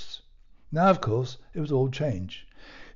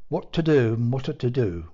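ordinary delivery

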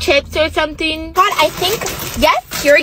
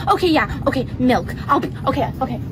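normal talking voice

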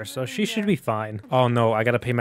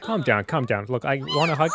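ordinary talk

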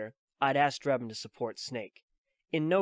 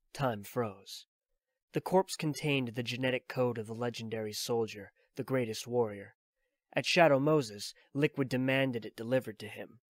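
ordinary delivery, nothing marked

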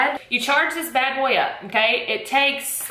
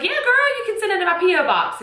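pitched way up, bubbly and eager; retold high girly reply; 9 semitones higher, faster than usual. Retelling her reply to Rhonda, high-pitched and bubbly. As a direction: squeal it like an eager friend. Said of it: "I was like".

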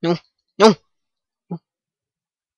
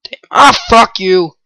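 normal talking voice

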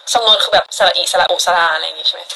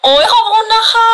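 high, louder squeal of thanks; 12 semitones higher, 3 dB louder than usual. Real, not acted. Streamer squealing thanks to viewers for a gift. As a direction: squeal out the thank-you, delighted.

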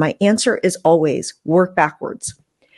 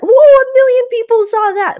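very high, louder stunned delight; excited squeal; 6 dB louder than usual. Pretend. Creator imagining a million views, high thrilled squeal. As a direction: squeal like the numbers just exploded.

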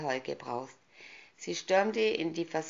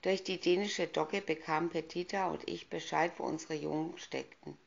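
usual speaking voice

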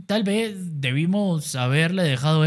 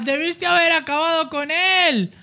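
frustrated teammate; 11 semitones higher than usual. Acted. Teammate complains fight stopped.

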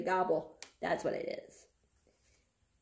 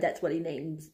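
ordinary talk

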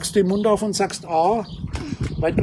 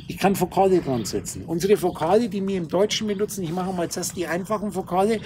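ordinary talk, nothing marked

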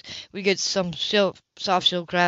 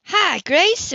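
high, louder happy hello; boyish happy greeting; 10 semitones higher, 5 dB louder than usual. Acted. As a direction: call out with pure boyish delight. Boy greets Grace happily.

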